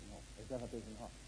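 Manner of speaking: soft, quick under the breath; hushed rapid aside to the listeners. Acted. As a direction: drop it low as if to himself. Quiet repeat of the point.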